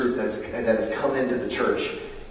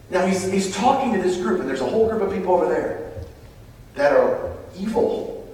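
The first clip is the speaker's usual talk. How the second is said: ordinary talk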